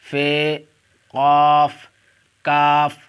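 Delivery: each letter called out loud and separate; alphabet recitation. Shown as a demonstration. Teacher calling out Urdu letters to online students, loud and deliberate. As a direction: drill the letters slowly for the class.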